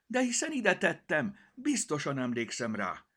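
put-on girl crying out in alarm; cried out. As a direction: call out in dismay. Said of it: "kiáltotta".

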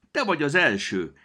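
put-on high, loud joyful call to the giant girl; excited gnome calling to the newcomer. A narrator's reading. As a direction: call out with glee. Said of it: "kiáltotta felé".

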